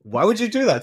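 climbing high in mock disbelief; mock incredulous. Writer explaining what cops ask tattooed gangs, mock incredulous. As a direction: spell out the obvious, pitch rising in comic exasperation.

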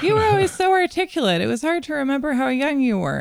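high, quick mom imitation. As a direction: mimic mom lightly, fast.

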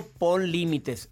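ordinary talk, nothing marked

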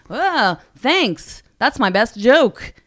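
acted heckle-back, punched at the end; mock comeback. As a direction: toss it back at a silent crowd. Imagined comeback to silent audience.

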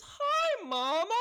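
high, loud hammed-up greeting; mock gushing greeting. Imitating a daughter greeting her mother at rehab pickup, mock gushing. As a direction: sing out an overdone hello, way up high. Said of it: "picks her up from rehab ... and she goes".

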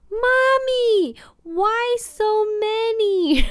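put-on high, slow child's wail; son's voice. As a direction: imitate a small boy whining at mom. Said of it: "我儿子也在说". (模仿儿子拉长声音的撒娇)

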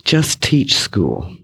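slow and soft, each word placed; slow quiet repeat. Real, not acted. Reflecting to the audience, slow quiet repeat. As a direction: draw it out gently. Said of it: "the next right thing was to just teach school".

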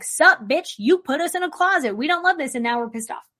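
a hint of irritated birds talking back; angry birds. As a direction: voice the birds as a cranky gang. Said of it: "all these birds are like".

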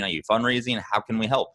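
ordinary delivery

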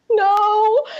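loud, very high drawn-out no; indignant denial. Retold or imitated. Answering the girl's court-order question, retold indignant denial. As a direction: burst out high and loud, mock affronted.

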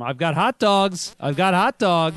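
mock hot dog vendor calling out loud; loud, drawn-out sales holler; vendor's yell. Co-host imitating a stadium hot dog vendor, yelling. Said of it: "he was yelling for him".

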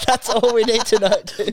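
high laughing agreement. Real, not acted. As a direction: chuckle it out to your mate. Laughing with his mate.

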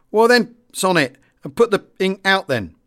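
lightly acted withering comeback; heavy sarcasm. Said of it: "with as much sarcasm as I could muster".

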